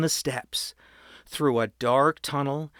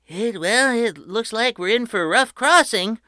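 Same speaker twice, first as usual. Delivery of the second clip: Mrs Jenkins voice; 8 semitones higher, 5 dB louder than usual. A narrator's reading. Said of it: "Missus Jenkins said".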